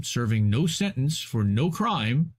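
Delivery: usual speaking voice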